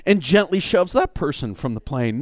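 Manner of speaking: usual speaking voice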